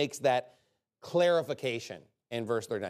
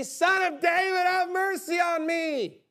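high, loud, slow cry for mercy; screamed plea; 3 dB louder, slower than usual. Acted. Beggar shouting to Jesus, a high desperate scream. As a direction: cry out to be heard from far away. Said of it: "They scream out".

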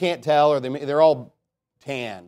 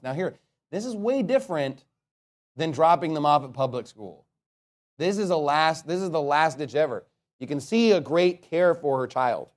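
ordinary delivery

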